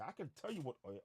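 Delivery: usual speaking voice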